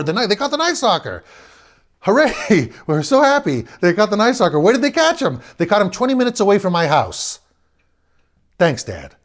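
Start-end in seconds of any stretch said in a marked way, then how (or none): 0.0-7.4 higher and louder, overjoyed; excited neighbour. Retold or imitated. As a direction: shout the good news. Neighbour bursting in cheering.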